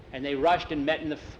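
plain reading voice

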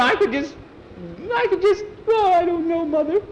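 very high, loud, broken-off fuming; exasperated sputtering. Acted. Nancy fumes to her mother about Skeeter, exasperated sputtering. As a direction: sputter in a squealing high voice, lost for words.

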